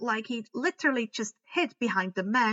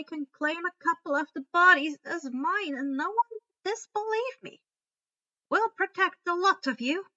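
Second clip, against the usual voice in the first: higher, lightly voiced proud grandmother; spirited old lady; 6 semitones higher, 3 dB louder than usual. A narrator's reading. Grandmother reassuring the others, higher and spirited. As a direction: brush off the worry with pride.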